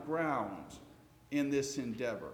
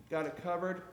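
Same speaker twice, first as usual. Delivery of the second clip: normal talking voice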